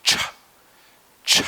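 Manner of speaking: ch said as a short T blended into Sh; ch sound. Shown as a demonstration. Teacher shows how to blend the sounds into ch. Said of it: "Like this".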